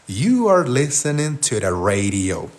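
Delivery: slow, drawn-out English for learners; slow model sentence. Shown as a demonstration. Teacher giving the class the complete sentence, slowly. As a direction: say each English word slowly.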